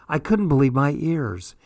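ordinary delivery

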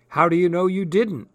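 sharp, pointed question. Acted. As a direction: ask it sharply, probing. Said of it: "looked at him sharply".

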